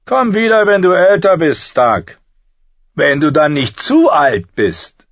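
high, loud jeering voice; taunting burned knight. A narrator's reading. Knight dismissing young Stark, loud taunting.

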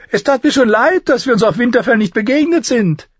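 high, loud dwarf lord voice; calling out. A narrator's reading. Dwarf regrets missing her at Winterfell, calling out high. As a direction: call across the room, pitched high. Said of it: "rief er".

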